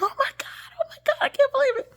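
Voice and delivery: high, fast, breathless whispered squeal; starstruck whisper. Retold or imitated. Flight attendant whispering in awe after seeing the author's name, excited. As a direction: gasp it out like meeting a celebrity.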